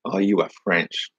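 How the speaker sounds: usual speaking voice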